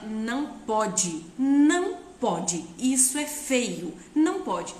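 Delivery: firm no. Shown as a demonstration. Mother holding the child's hand, firm slow scolding. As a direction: lay down the limit, word by word. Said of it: "segura na mão dela".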